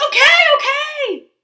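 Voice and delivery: high, loud placating squeak; high Duckling voice. Acted. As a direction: back off meekly. Duckling backs off.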